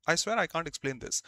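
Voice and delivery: ordinary talk, nothing marked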